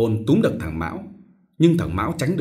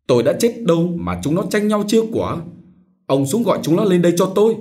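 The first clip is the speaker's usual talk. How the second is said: moaning rage; 6 semitones higher, 5 dB louder than usual. A narrator's reading. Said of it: "Thừa rên lên".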